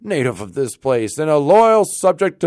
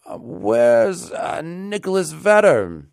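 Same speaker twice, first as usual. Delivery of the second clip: high, halting, uncertain question; hesitant inquiry; 11 semitones higher, 5 dB louder, slower than usual. A narrator's reading. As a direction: search for the name as you ask. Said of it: "Rip bethought himself a moment and inquired".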